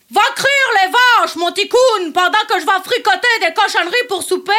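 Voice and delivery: put-on broad rural Charlevoix accent, projected; imitated Charlevoix accent. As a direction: quote the line in a thick Charlevoix country accent. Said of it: "j'ai de la misère avec l'accent". (accent de Charlevoix imité)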